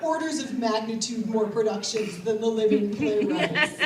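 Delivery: ordinary delivery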